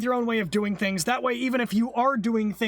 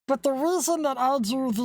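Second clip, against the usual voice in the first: alien voice; 3 semitones higher than usual. Real, not acted. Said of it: "you can have the alien voice for one minute".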